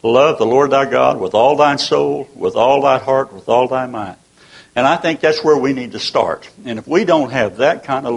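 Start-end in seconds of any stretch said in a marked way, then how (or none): 0.0-4.1 scripture reading. Acted. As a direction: read the verse with reverent weight. Quoting scripture.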